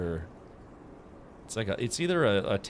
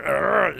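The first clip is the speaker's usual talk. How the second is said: frustrated growl; 6 semitones higher, 7 dB louder than usual. Real, not acted. Frustrated at crowded aquarium.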